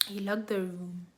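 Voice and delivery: whispered aside; hushed whisper. Real, not acted. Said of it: "I spoke, like, s-, um, very softly".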